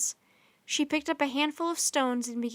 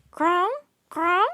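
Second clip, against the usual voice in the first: higher, louder ringing bird call; bell-like bird call; 6 semitones higher, 9 dB louder than usual. A narrator's reading. Raven answering Annie, high ringing bird call. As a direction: ring it out like a bell-voiced raven. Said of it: "Rock made soft bell-like sounds".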